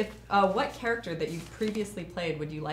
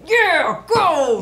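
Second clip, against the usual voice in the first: cheering; 11 semitones higher, 14 dB louder than usual. Pretend. Cheering a teammate on.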